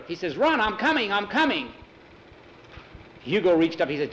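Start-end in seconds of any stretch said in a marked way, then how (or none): 0.4-1.7 high, hurried calling out; urgent call back. Acted. Nikolai answering Hugo while escaping, urgent call.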